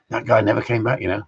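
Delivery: ordinary delivery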